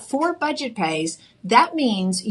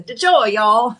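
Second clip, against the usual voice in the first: playful Texan twang; Texan accent; 4 dB louder, slower than usual. Shown as a demonstration. Host joking with laughing colleague about the brand name, in a Texan accent. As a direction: ham it up in Texan, amused. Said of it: "it sounds very Texan".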